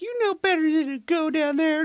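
put-on high falsetto girl voice; mock girl's scolding. Girlfriend warning the jock, high falsetto scold. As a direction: squeak it like a prissy girlfriend. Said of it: "she's like".

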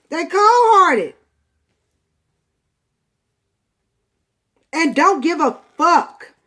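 loud, heated, rising rant about a cruel person. Real, not acted. Tarot reader venting at the cruel person, loud and outraged. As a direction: build to an outraged near-shout.

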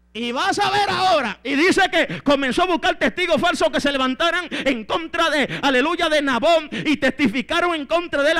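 loud, high, driving sermon delivery; fired-up preacher; full-force preaching. Acted. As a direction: hammer out the story like a revival preacher.

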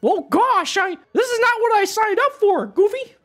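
high squeaky cartoon mouse; Mickey voice. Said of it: "Stop with the voices".

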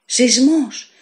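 high, loud frightened groan; groaning in fear. A narrator's reading. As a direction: moan it out in terror. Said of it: "έλεγε στενάζοντας".

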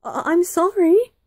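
high, loud, quick stammered apology; nervous spluttering. A narrator's reading. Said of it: "he spluttered".

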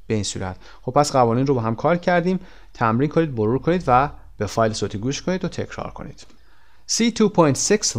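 normal talking voice

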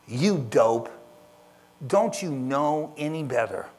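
loud contemptuous scold. Acted. Parent scolding child who ran into the street, loud and cutting. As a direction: snap at the kid with disdain.

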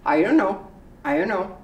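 "I don't know" squeezed into "I'onno"; tiny mumbled. Shown as a demonstration. Teacher showing the smallest spoken "I don't know". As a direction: shrink it to almost nothing. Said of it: "as small as this".

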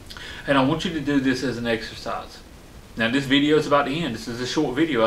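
ordinary talk

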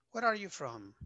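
"Where are you from?" with the pitch dropping at the end; falling tune. Shown as a demonstration. Teacher models a falling question for students, tune sliding down. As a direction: let the question fall, stress on "are". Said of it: "como que va hacia abajo".

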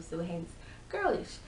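normal talking voice

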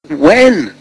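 high, eager squeak; excited squeak. A narrator's reading. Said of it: "they squeaked in unison".

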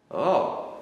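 loud sudden oh; surprised exclamation. Acted. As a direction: react to an unexpected find. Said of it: "zdziwił się Niemiec".

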